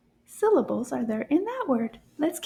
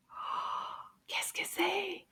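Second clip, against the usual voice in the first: softer, slow wondering whisper; curious whisper; 5 semitones higher, 13 dB quieter, much slower than usual. Acted. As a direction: peek in and ask very softly. Peeking into the bag. (chuchotement curieux et lent)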